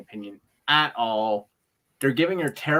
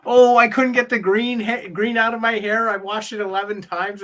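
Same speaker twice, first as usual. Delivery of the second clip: higher, louder, hammed-up complaint about her hair; mock Asuka whine; 6 semitones higher, 6 dB louder than usual. Fan imitating Asuka's over-the-top hair complaint, hammed-up. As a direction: play the wrestler griping over green dye. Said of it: "and she's like".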